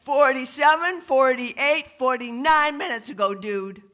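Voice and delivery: high, loud excited stoner voice; stoned driver. Acted. Stoned newlywed leans out to the cop, high loud gushing. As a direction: crow it high, barely coherent.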